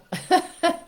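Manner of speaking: bright high burst of laughter; real laugh. Real, not acted. Grandmother laughing about her grandson's no.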